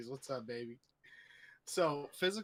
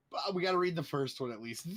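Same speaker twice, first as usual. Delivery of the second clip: ordinary talk, nothing marked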